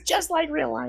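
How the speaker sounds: high sarcastic lilt. Real, not acted. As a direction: tease it with a high voice. Joking about shitty conditions.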